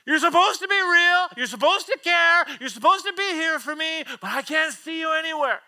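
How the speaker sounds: loud, high plea flung up to God; desperate cry. Acted. Believer wondering where God is, desperate shout. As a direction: shout it out, drawing out the opening question.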